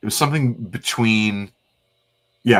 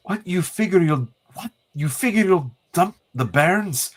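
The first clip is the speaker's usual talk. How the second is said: put-on a higher Scottish accent that climbs in outrage; indignant Scottish accent; 10 semitones higher than usual. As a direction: berate him in a broad Scottish accent. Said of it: "the compliment on the Scottish accent".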